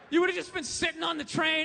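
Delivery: usual speaking voice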